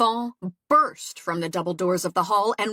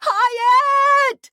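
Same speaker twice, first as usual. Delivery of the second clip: loud high call across the yard; shout; 9 dB louder than usual. A narrator's reading. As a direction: shout out toward the stable. Calling toward the stable.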